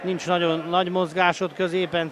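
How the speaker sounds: ordinary talk, nothing marked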